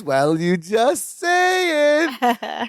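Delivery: slow, drawn-out singsong imitation; sing-song urging. Imitating Larry mimicking Balky to coax him, sing-song urging. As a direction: echo the singsong, stretching the last words.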